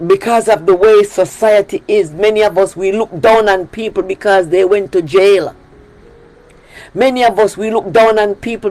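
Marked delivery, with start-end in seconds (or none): none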